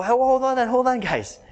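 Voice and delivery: upbeat leaders calling out. Retold or imitated. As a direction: call the crowd to stop. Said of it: "They go".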